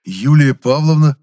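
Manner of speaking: stunned slow rising question. A narrator's reading. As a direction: caught off guard, gasping the name. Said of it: "опешил я".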